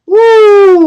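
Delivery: high, loud whoop of joy; spontaneous celebratory whoop; excited woo. Real, not acted. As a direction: let out a sudden cheer. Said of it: "Why did I say it like that?".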